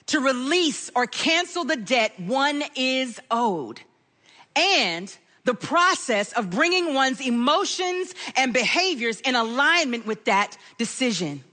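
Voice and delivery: usual speaking voice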